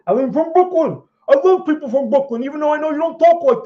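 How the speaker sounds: put-on high, loud, thick Brooklyn accent; hammed-up Brooklyn accent, loud and high. Streamer to chat, a hammed-up loud Brooklyn accent. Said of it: "I just like to do the impression".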